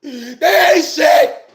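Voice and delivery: loud, hammed-up shouts of agreement; fan cheering on. Viewer backing Cynthia G's video, hammed-up shouting. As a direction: yell it in short bursts, like a riled-up fan. Acting out fired-up fans agreeing.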